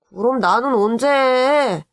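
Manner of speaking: put-on high, drawn-out kid complaint; whining child. Boy asking uncle when he can shave, whining. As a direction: stretch it into a boyish whine.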